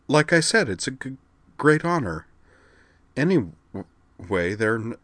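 high, halting stammer; stuttering shy girl. Acted. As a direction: stumble nervously over each word. Kate voiced with a stutter.

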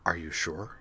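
soft, tense question; quietly urgent. A narrator's reading. Skuld asks Uhtred in a soft, urgent hush. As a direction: hush it but press for an answer. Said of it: "said in a quietly urgent tone".

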